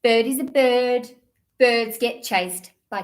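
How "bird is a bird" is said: usual speaking voice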